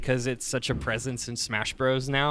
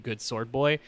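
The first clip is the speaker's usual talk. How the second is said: usual speaking voice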